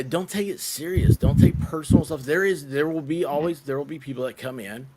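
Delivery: normal talking voice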